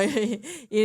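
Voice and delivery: ordinary talk, nothing marked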